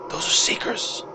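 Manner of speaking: secretive, half-whispered dwarf; dwarf's hushed warning. Acted. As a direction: warn them under your breath, in character.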